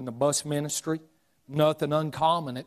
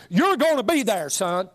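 high, emphatic retold order; father's firm command, pitched up; stern father; 6 semitones higher, 4 dB louder than usual. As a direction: lay down the law as an imitated dad. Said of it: "But Daddy said I needed".

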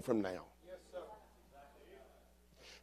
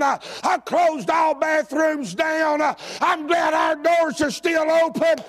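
fiery preaching; 11 semitones higher than usual. Acted. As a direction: whip the congregation up, calling out each line. Pastor preaching at full force.

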